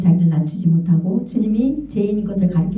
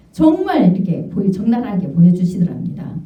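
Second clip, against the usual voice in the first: normal talking voice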